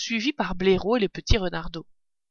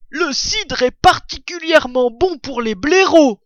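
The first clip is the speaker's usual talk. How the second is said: loud, raised badger voice; enthusiastic badger; 7 semitones higher, 8 dB louder than usual. A narrator's reading. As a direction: proclaim it high and hearty. Voice of a story figure. (blaireau enthousiaste, voix haute et forte)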